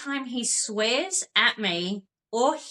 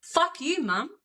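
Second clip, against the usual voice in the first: put-on reading child's note; 2 semitones higher than usual. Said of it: "Let me read you, said note".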